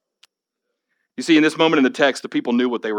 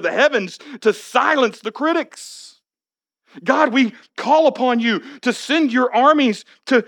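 higher, slow pleading prayer; fervent prayer; 9 semitones higher than usual. Acted. The people praying to God for rescue, fervent.

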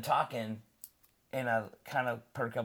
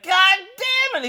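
loud, much higher curse; retold outburst; 14 dB louder than usual. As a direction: yell it at the phone. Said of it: "I was like".

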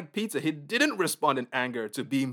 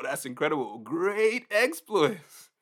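ordinary delivery

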